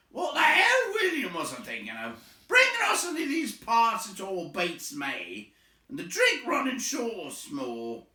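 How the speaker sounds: high, loud troll yelling; grumbling troll shout. Acted. Troll shouting at William about the drink running short. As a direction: bellow the complaint at William. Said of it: "he said, jugging the elbow".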